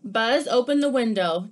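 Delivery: lively picture-book read-aloud; animated storybook reading; reading to children. Acted. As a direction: read it like story time for kids.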